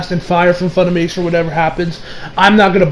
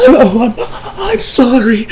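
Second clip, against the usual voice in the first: mock crying; 5 semitones higher, slower than usual. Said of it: "she's not even really crying".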